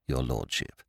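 very soft deferential "your" leaning down; hushed genteel host. Acted. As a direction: lay weight on "your", falling gently. Butler addresses the lord.

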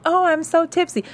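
high sing-song mockery; mocking fake tipsy. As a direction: mimic a fake tipsy girl. Mocking her fake tipsiness.

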